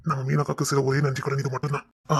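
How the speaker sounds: ordinary talk, nothing marked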